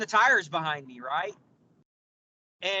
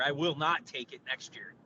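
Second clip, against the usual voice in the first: ordinary talk, nothing marked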